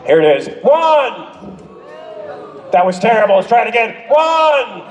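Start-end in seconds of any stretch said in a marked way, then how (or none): 0.6-1.3 shouted cue. Shown as a demonstration. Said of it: "Don't forget your cues". 4.1-4.8 shouted countdown. Shown as a demonstration. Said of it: "Don't forget your cues".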